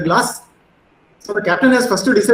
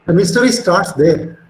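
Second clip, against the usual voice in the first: louder, slow, emphatic delivery; 5 dB louder than usual. Real, not acted. Lecturer telling students where the mystery begins, emphatic. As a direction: drive the key point home loudly and slowly. Lecturer stresses the key point.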